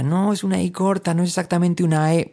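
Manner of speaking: high, protesting pedant; fussy purist. Acted. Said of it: "un purista diría".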